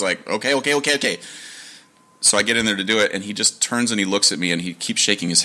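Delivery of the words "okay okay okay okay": overexcited babbling. Retold or imitated. Flustered reply to mom, retold.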